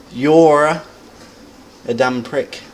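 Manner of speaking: "You're" said loud and high, then a pause; "You're" loud, the rest dropped back; stress on YOU'RE. Shown as a demonstration. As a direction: punch "You're" alone. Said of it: "that word that you emphasize is the subject".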